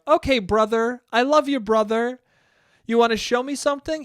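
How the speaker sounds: put-on eager little kid. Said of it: "was like".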